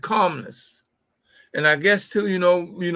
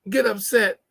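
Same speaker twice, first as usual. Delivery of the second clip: normal talking voice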